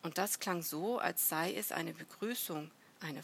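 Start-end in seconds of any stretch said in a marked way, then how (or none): none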